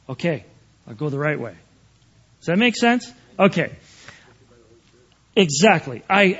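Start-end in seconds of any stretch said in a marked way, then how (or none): 0.1-1.5 very soft, low, acted concession; meek acceptance. Preacher acts himself accepting the Lord's correction, softly and meekly. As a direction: give in quietly, under your voice. Acting out his meek realization.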